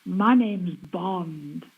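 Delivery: low, very slow spy introduction; James Bond. Acted. Asked to do the impression by her co-host, low slow Bond voice. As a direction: draw it out deep and deliberate. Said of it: "could do a good James Bond impression".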